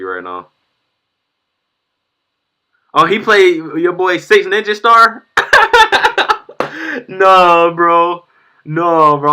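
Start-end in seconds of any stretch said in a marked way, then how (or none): 2.9-5.3 excited. Real, not acted. Sees rival's video, shouts. 5.3-7.0 shrieking burst of laughter; loud high laugh. Real, not acted. 7.1-9.3 laughing. Real, not acted. Laughing disbelief.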